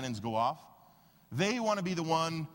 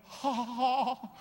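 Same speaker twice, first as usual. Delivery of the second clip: fake laugh; 7 semitones higher than usual. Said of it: "kinda looked away and went".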